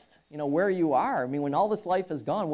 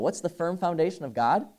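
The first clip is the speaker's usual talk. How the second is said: normal talking voice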